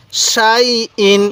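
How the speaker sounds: সাই ইন read very slowly, both syllables drawn out; slow drawn-out recitation. Shown as a demonstration. Teacher reciting সাই ইন to students, slowly drawn out. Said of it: "কীভাবে পড়ব".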